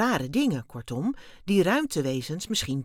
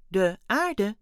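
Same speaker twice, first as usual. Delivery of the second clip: mildly surprised, higher and louder; 4 semitones higher, 6 dB louder than usual. A narrator's reading. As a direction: react with a touch of astonishment. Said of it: "zei Wim verbaasd". (licht verbaasd)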